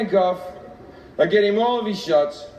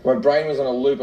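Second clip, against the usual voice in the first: ordinary talk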